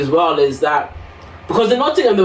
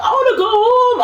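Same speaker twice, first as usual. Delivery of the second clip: high, half-laughing tearful whine, much higher; a hammed-up wail to go home; husband hearing her hysterical retold plea; mock hysterical wail. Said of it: "all sorts of hysterics".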